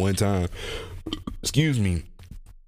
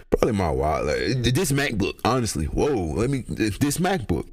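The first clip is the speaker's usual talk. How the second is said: ordinary talk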